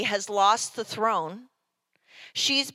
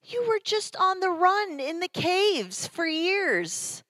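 higher, mocking voice of a bitter wife; hammed-up higher voice of Michal scolding David; resentful wife; 9 semitones higher than usual. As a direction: throw his hardships back at him with scorn. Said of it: "She's like".

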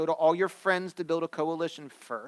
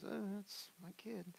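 put-on higher, slow and soft, a resigned "Oh, that's my kid"; rueful sigh; 5 semitones higher, 14 dB quieter, slower than usual. Parents embarrassed by their child, slow rueful sigh. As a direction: sigh it out in mock disappointment.

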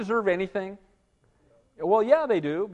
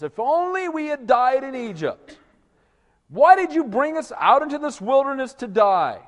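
complaining crowd; 7 semitones higher, 6 dB louder than usual. Retold or imitated. People murmuring against Moses.

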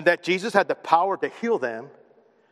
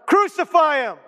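high, raised shout of a mob; crowd's shout; 7 semitones higher, 4 dB louder than usual. Acted. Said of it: "crowd shouts louder and louder".

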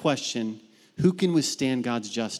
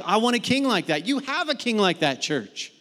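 excited preaching; 7 semitones higher than usual. Real, not acted. Preacher rising to climax.

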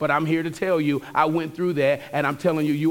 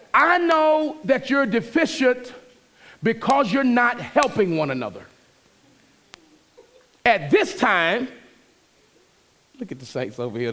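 louder, higher preaching push; 6 semitones higher, 3 dB louder, slower than usual. Acted. Pastor exhorting the church about correction, fervent preaching. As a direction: drive the point home from the pulpit.

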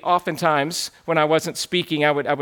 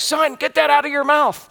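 raised-pitch parental command, retold; urgent mock scolding, pitched up; scolding dad; 7 semitones higher than usual. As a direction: imitate an alarmed dad correcting a toddler. Dad scolds toddler in retold scene.

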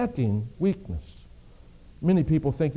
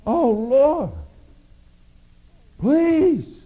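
much higher and louder, near breaking; anguished cry; 8 semitones higher, 7 dB louder, much slower than usual. Acted. Paul's third plea climbs to anguish. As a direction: reach for God at full strength. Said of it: "Paul cried out to God".

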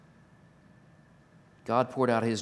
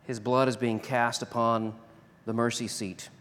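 ordinary talk

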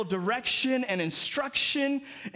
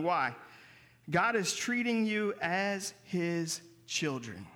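usual speaking voice

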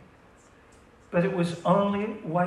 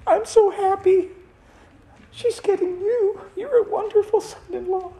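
much higher, louder gushing voice; mock sweet dad; 6 dB louder than usual. Father of the bride gushing to the groom, high and sugary.

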